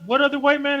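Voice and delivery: high, puzzled mock voice; squeaky clueless viewer imitation; confused moviegoers. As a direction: play baffled audience members. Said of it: "other people were sitting next to me like".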